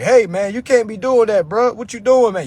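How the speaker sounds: high, loud mock objection; nagging coworker. Coworker catching him live on the dock, loud mock scolding. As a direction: call him out like an indignant workmate.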